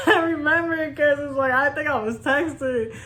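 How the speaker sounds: real giggles while remembering; laughing through the memory; amused, laughing aside. Real, not acted. Recalling the phone incident.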